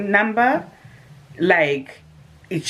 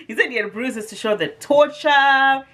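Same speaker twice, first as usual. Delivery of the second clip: words through real laughter; laughing; 9 semitones higher than usual. Real, not acted. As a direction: still laughing while saying it. Laughing while retelling his claim.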